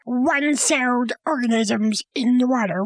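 ordinary talk

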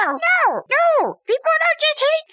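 high, indignant protest; indignant objection. Acted. Listener objecting to hating things for no reason, indignant. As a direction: cut in with sharp disagreement.

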